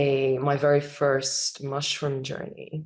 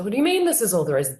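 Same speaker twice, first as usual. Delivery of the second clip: frustrated retort; 6 semitones higher, much faster than usual. Retold or imitated. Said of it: "I'm getting really frustrated".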